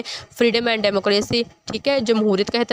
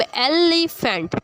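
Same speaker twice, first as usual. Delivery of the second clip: "Elephant" slowly, broken as एलि, फेंट; syllable by syllable; 4 semitones higher, much slower than usual. Shown as a demonstration. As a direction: stretch "elephant" out in separate parts. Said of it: "एक एक आवाज़ में एक तरफ". ("Elephant" धीरे, हिस्सों में तोड़कर)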